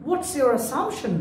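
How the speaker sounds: usual speaking voice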